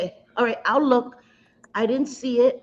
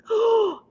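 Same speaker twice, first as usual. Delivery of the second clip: sharp gasp. Real, not acted. Realizing she forgot the link.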